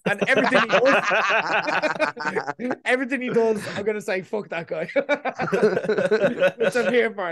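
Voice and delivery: laughing. Real, not acted. Teasing friend, laughing throughout.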